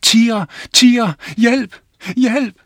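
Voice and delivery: high, loud scream for help; boy screaming at the real tigers. Acted. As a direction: scream as loud as you can from the tree. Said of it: "skreg, så højt han kunne".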